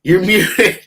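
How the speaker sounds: laughing through the quote; mock quote broken by a laugh. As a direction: giggle while imitating. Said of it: "George is like".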